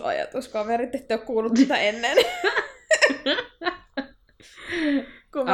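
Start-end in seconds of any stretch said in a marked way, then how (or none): 2.2-5.5 laughing. Real, not acted. Laughing at own joke.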